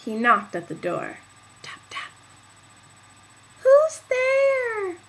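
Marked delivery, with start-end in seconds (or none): 1.6-2.1 unvoiced tap tap; whispered knocking sound. Knocking on door. 3.6-5.0 very high, loud granny call; high old woman's voice; old grandmother. Acted. Grandmother calls from bed.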